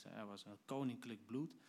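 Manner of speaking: ordinary talk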